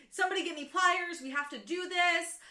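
fast, urgent quoted command; urgent order. Retold or imitated. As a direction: act out a stressed, rushed call for help. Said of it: "A stressful situation".

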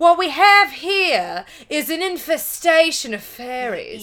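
high, loud put-on London voice; hammed-up cockney delivery; mock London accent. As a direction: say it like a London geezer. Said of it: "you sound like you're from London".

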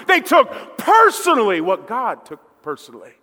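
fervent preacher's climax; preaching at full force. Acted. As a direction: drive the point home from the pulpit.

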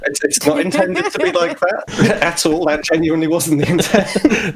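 helpless laugh breaking into the words; laughing protest. Real, not acted. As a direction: giggle while insisting it was innocent. Laughing over the accidental rude name.

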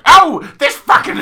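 loud, high, slow gruff grumble; rough coal miner voice. Pretend. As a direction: play a cranky coal miner, laughing. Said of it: "she wasn't like".

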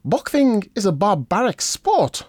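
high, slow, grandly formal voice; pompous boxer impression. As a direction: pronounce it like a lofty orator. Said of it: "I'll do an impression".

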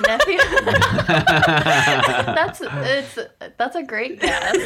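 laughing. Real, not acted. Laughing at a silly guess.

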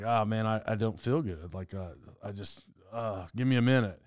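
imitating a hurt man, low and soft; understated mock moan; mild moaning. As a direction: groan it lightly, like retelling someone winded by pain. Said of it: "He was, like, moaning".

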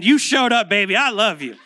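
a playful gush of praise from the wife; doting wife. Retold or imitated. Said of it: "my wife ... saying".

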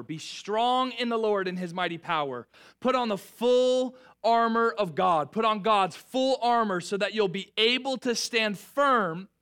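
measured, solemn reading of Ephesians; scripture reading. Acted. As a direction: read it like a proclamation over the church. Reads scripture to congregation.

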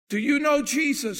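loud, high confronting question; forceful challenge. Retold or imitated. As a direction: throw the question out at full volume. Preacher confronts the possessed boy.

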